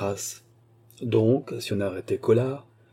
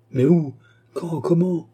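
louder, higher, urgent questions; upset, raised-voice demand for details; shaken questioning; 7 semitones higher, 4 dB louder, slower than usual. A narrator's reading. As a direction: fire off questions in shock. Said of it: "bouleversé par cette nouvelle".

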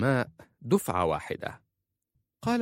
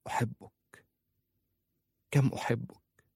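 usual speaking voice

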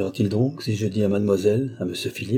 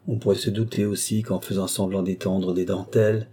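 ordinary delivery

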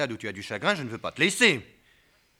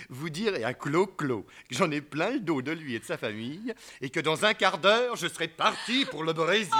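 lightly voiced woman, laughing through; mock quote laughing; 3 semitones higher, 6 dB louder than usual. Husband laughingly quoting the rude woman on the phone to his wife. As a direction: retell her words with amusement. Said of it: "une espèce de voix d'homme".

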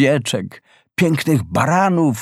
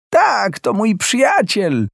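high, slow, cheerful hare voice; cheerful call; 10 semitones higher than usual. Acted. Hare cheerfully greets his friend with a high call. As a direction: brighten up with joy. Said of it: "zawołał wesoło zajączek".

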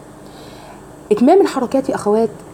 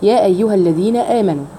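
ordinary talk

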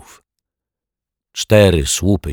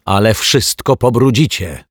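higher and louder scolding; angry rebuke; 5 semitones higher, 5 dB louder than usual. A narrator's reading. Mrs Majewska scolding Zuzia, angry and loud. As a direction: scold her sharply. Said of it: "spojrzała na nią z gniewem".

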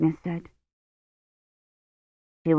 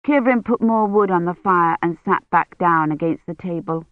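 ordinary delivery, nothing marked

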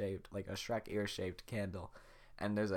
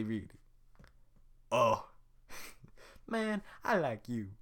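ordinary talk

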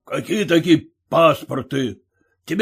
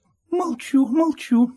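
high pleading wife's voice; timid merchant's wife pleading, voiced high; 9 semitones higher than usual. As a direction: beg the husband to let her listen, pitched high. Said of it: "спохватилась она".

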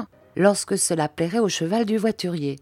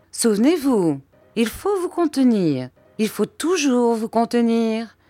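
higher, insistent reminder; emphatic, pitched-up admonition; admonishing husband; 6 semitones higher than usual. A narrator's reading. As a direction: lecture your wife on self-control. Stepfather admonishes his wife.

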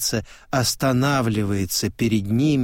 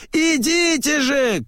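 high, insistent call; shouting. A narrator's reading. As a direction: urge the crowd over in a high cry. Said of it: "кричит".

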